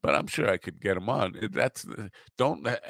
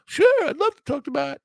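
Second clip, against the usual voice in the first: high, loud mock agreement; Peter; 7 dB louder than usual. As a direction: caricature someone eagerly saying yes. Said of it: "Peter's first inclination would probably be".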